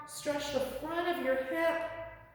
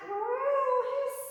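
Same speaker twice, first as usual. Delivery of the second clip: high, louder playful meow then a hiss; angry cat; 10 semitones higher, 4 dB louder than usual. Pretend. Yoga teacher as angry cat for little yogis, high meow. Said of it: "angry cat".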